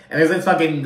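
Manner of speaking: normal talking voice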